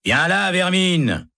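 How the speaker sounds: high, slow barked command; harsh shout. A narrator's reading. Guard yelling at the prisoner, high and slow. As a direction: order him out with contempt. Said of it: "crie un gardien".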